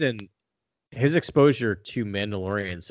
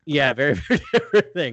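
laughing; 5 semitones higher, 5 dB louder than usual. Real, not acted. Echoing a friend's joke.

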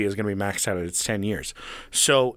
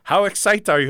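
higher, louder caller imitation; lightly voiced pushy agent; a hint of a robotic telemarketer; mock salesman; 8 semitones higher, 5 dB louder than usual. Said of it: "He's like".

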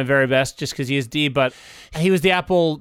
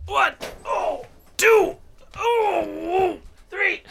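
psyched up; 8 semitones higher, slower than usual. Real, not acted. Psyching up before the countdown.

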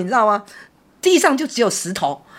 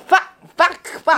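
mock cursing; 8 semitones higher, much slower than usual. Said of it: "跟鬼吵架".

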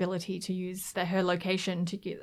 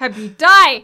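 loud, higher jeer; mock heckling; 5 semitones higher, 17 dB louder, slower than usual. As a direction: yell it as a joking curse.

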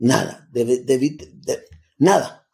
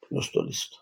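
whispered; 11 dB quieter, faster than usual. Retold or imitated. To himself, whispering he is ready for the shaman. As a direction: repeat it in a bare whisper.

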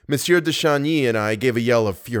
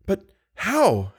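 high-pitched helpless question; tearful; 9 semitones higher than usual. A narrator's reading. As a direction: ask it tearfully in a higher girl's voice. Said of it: "asked the poor girl tearfully".

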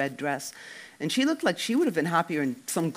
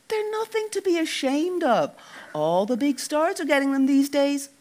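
mock reassurance; 11 semitones higher, 4 dB louder than usual. Mock reassuring teasing Dec.

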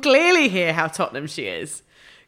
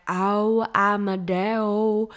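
mock [name] impression; much slower than usual. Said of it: "they'll start by being like".

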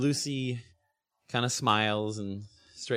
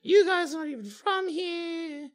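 put-on high, plaintive girl voice; teary schoolgirl. Schoolgirl explaining why she was mad at friends, teary and high. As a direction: plead it out, close to tears. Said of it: "her eyes kinda get teary".

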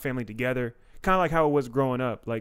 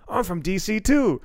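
higher, louder, delighted friend's voice; impression of Dante; 7 semitones higher, 5 dB louder than usual. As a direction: mimic the friend's happy surprise. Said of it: "That was my impression of Dante Chang".

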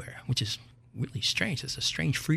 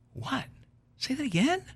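ordinary talk

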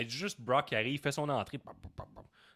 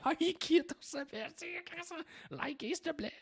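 mock fans. Mocking fans retweeting.

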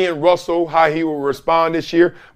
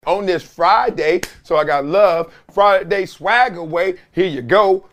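normal talking voice

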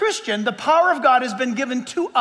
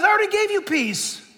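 mock shout from God; 8 semitones higher than usual. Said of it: "He shouts down and says".

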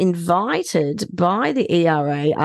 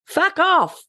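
mock curse; 11 semitones higher than usual. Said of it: "The headline had me".

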